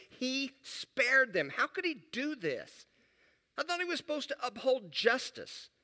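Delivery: Jonah ranting in his journal, fed up with mercy; indignant Jonah. Acted. Jonah venting to his journal about God sparing Nineveh, loud and outraged. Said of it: "Now that sounds a little over the top".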